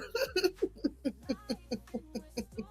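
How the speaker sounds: soft, long trailing giggle; real giggle. Real, not acted. Streamer tickled that she learned it too, giggling. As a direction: giggle away quietly at yourself. Laughs at own admission.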